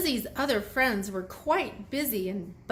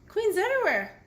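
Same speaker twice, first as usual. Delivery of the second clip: excited; 11 semitones higher, faster than usual. Real, not acted. Excited spotting queens in pictures.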